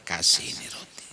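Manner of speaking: hushed whispered offer. Acted. Abu Bakar whispering as he hands over bread.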